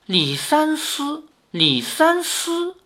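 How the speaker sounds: slow, drawn-out, musing over the name; pondering murmur. Acted. Old woman frowning as she recalls the name, slow musing. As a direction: turn the name over slowly, lost in thought. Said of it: "老夫皱眉沉吟".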